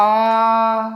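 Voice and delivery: a long आ with the mouth fully open. Shown as a demonstration. Teacher demonstrating the wide-open vowel आ. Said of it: "पूर्णतः खुला रहेगा मुंह।".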